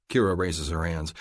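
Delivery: ordinary delivery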